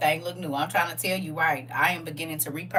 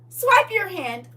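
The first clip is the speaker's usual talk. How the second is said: higher, slow robotic command; NPC voice; 10 semitones higher, 6 dB louder, slower than usual. Pretend. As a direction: drag it out like a machine. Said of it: "my version of the N P C".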